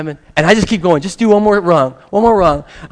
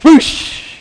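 breathy rushing whoosh; whoosh sound; 13 semitones higher, 9 dB louder than usual. Retold or imitated. Imitating the fall into the water, breathy whoosh. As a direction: make the rush of the fall. Falling off the high dive.

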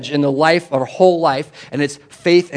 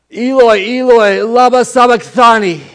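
slow, high, full-voiced cry; loud cry; 8 semitones higher, 11 dB louder, slower than usual. Shown as a demonstration. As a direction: cry it out loud, drawing out the words. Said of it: "he says it in a loud voice".

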